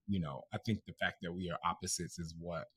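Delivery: ordinary talk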